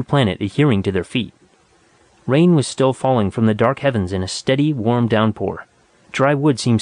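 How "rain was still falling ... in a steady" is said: ordinary delivery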